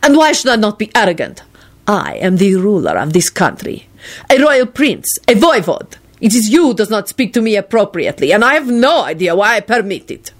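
haughty prince. A narrator's reading. Radu asserts his rank to Lupin, haughty and indignant. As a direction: proclaim your rank with wounded pride.

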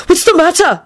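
very high, panicky question; flustered concern, pitch jumping up; stammered alarm. A narrator's reading. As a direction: blurt it out in alarm. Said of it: "he stammered".

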